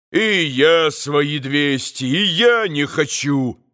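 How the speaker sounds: ordinary delivery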